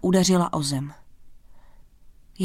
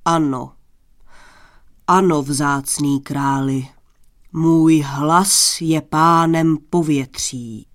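slow, low, booming solemnity; stately low proclamation, drawn out; grave; 4 semitones lower, 4 dB louder, slower than usual. A narrator's reading. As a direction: declare your power to the king in dead earnest. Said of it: "vážně přikývl a řekl".

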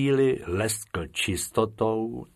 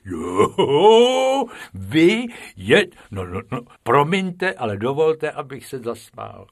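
laughing words, louder and higher; keeper laughing; 5 semitones higher, 6 dB louder than usual. Acted. The keeper laughing at Bob and Bobek, laughing. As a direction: laugh while you speak. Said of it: "smál se, až se prohejbal".

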